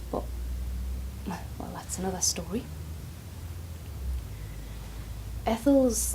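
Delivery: whispered, as if the boss might hear; soft whisper of worry, breaking bad news; hushed confiding. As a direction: lean in and whisper the secret.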